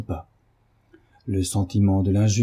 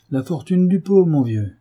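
jovial bailiff; 7 semitones higher, 4 dB louder than usual. A narrator's reading.

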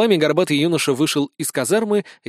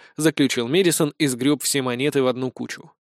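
usual speaking voice

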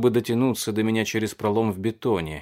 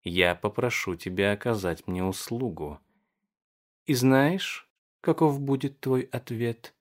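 femme fatale. A narrator's reading. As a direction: purr it like a temptress. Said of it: "промурлыкала она".

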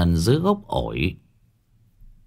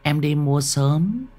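sweetly plead, with something wrong underneath; a ghostly girl coaxing the old woman to open up; eerie girl's voice; 6 semitones higher than usual. A narrator's reading. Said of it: "tiếng cười khúc kha khúc khích".

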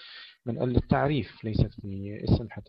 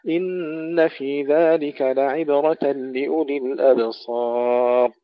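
slow, louder, drawn-out chanted recitation; Quran recitation; 4 semitones higher, 7 dB louder, much slower than usual. Acted. Reciter chanting the verses to his students in a slow tajweed style. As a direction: intone each verse slowly and resonantly.

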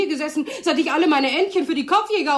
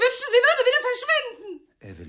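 nervous laugh; 10 semitones higher than usual. Acted. Jealous laughing remark.

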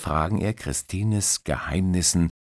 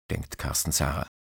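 plain reading voice

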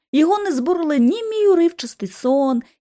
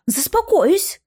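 grumbled retort; 7 semitones higher, much slower than usual. A narrator's reading. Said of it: "буркнула я".

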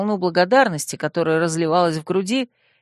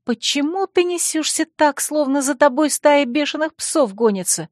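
hissing out of breath; 8 semitones higher than usual. Acted. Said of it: "Царья, запыхавшись, прошипела".

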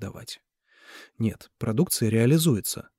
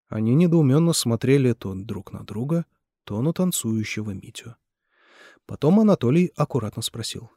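usual speaking voice

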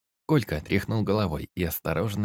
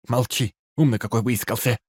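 angry scolding; 4 semitones higher, 5 dB louder than usual. A narrator's reading. Бабка одёргивает Кольку.

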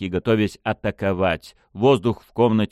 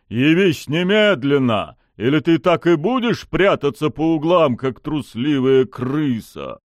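loud, high, angry taunt; barked challenge; 7 semitones higher, 5 dB louder than usual. A narrator's reading. Pushkin taunts the hiding lady, barking angrily. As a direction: bellow for the coward to appear. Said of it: "рявкнул он".

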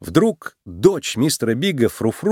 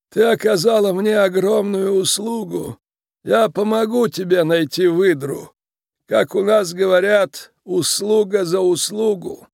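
high, creaky voice with solemn authority; creaky, grateful mob boss; 8 semitones higher than usual. Acted. Tiny boss repays Judy for saving his daughter, creaky and commanding. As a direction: pronounce the favour in a measured creak.

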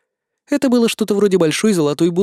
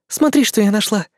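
excited female voice; 5 semitones higher than usual. Acted. Demoness shows her find.

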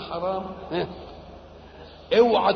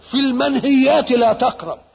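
loud, higher, forceful ruling; emphatic warning; 4 semitones higher, 10 dB louder than usual. Real, not acted. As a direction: drive the rule home at full voice. Drives home rule for prohibitions.

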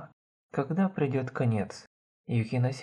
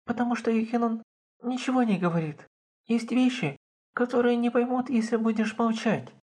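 put-on high, strained girl's voice on the verge of tears; forcing words out; 8 semitones higher than usual. A narrator's reading. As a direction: push each word out, eyes wet. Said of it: "выдавливая слова одно за другим". (высокий надломленный девичий голос)

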